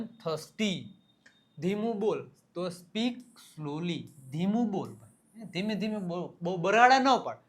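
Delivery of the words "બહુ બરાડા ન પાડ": raised-voice mock reprimand; loud acted telling-off; mock scolding. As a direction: scold someone for yelling, loudly. Acted scolding of someone shouting.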